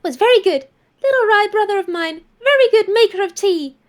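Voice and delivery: a cheerful little house spirit cooing; chirpy spirit voice praising the tea; high sing-song spirit creature. Acted. Network voice.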